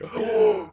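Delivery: loud, shocked intake of breath; sharp gasp. Retold or imitated. Fan acting out their reaction to a made-up word, shocked gasp. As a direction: gasp as if scandalized. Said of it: "I'm like".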